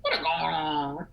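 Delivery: slow, drawn-out attempt at a Jamaican greeting; Jamaican accent. Shown as a demonstration. Trying the Jamaican phrase for a friend, drawn-out Jamaican accent. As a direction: stretch it out in a tentative Jamaican accent.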